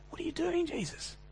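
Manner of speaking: high, hushed and aghast; shocked Peter. Retold or imitated. Peter, horrified, hushes Jesus in shock. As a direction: gasp it out in disbelief at Jesus. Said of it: "Peter can't take it".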